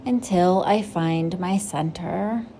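gentle, unhurried meditation guide voice; guided meditation. Acted. Host guiding listeners into breathing, calm meditation voice. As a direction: settle the listener into stillness.

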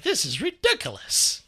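high-pitched mock exasperation; exasperated complaint. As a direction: retell your own fed-up outburst, voice jumping up. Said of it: "I was like".

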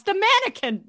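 all caps excitement. Retold or imitated. Host reading the notes, excited. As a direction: shout it like an exclamation point. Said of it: "literally all caps".

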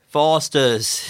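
broad Aussie, ad-style; Australian accent. Pretend. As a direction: drawl it in mock Australian. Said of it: "Can you guys do it?".

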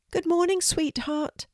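high, sweet mum voice; mummy's voice. A narrator's reading. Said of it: "she heard her mummy say".

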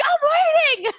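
high mock-bickering shout; exasperated yell. Friend defending the delay with the link, exasperated yell. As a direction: holler back like a nagged sibling. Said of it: "siblings who fight all the time".

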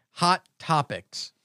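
slow, drawn-out announcer call; segment title. Acted. Host offering Rab the choice, slow announcer call. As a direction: draw out the title as an option.

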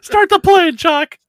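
very high, loud mock yell; hammed-up yelling. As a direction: holler it in comic panic. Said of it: "he starts yelling".

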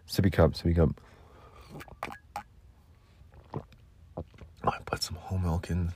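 soft breathy whisper. Real, not acted. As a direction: whisper to yourself. Whispered aside about cocoa.

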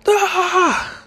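high, drawn-out "aah" of pain; pained yell. Retold or imitated. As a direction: imitate a cousin's sudden yell. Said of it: "I just hear him fucking yell".

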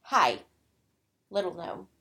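low, soft condescending witch; witch greeting. Retold or imitated. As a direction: purr it down at the little gnome. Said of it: "And the witch is like".

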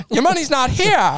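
very high vintage film voice; old-time movie talk. Acted. Banker answering an angry customer, high old-movie voice. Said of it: "how they talked back then".

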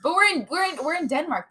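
playfully high and loud; teasing. Pretend. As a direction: tease her with a sing-song jab. Teasing the accent choice.

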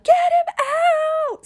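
desperate shriek mid-push; screaming. Retold or imitated. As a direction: scream it with everything. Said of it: "I screamed so loud".

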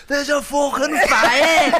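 high, slow, laughing Scouse shout; Scouse accent. Retold or imitated. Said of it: "very calmly in her Scouse accent goes".

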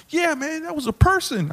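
very high, tearful mock whine; crying mad, imitated. Upset audience member answering the comic, mock crying mad. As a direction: squeak it out as if upset to tears. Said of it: "he was like crying mad".

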